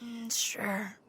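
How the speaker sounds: soft whispered agreement. Acted. Whispering back to a friend in the sleeping car. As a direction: answer in a bare whisper.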